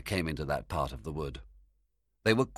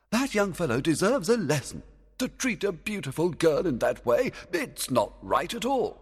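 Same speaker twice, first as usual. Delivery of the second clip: fairy king voice; 12 semitones higher, 4 dB louder than usual. Acted. Oberon disapproving.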